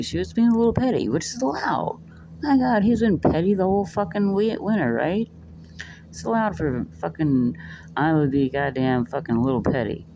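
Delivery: heated, high-pitched rant; getting fired up defending her; worked up. Real, not acted. Vlogger ranting to viewers, worked up and high. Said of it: "I get a little worked up there".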